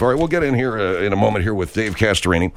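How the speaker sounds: ordinary delivery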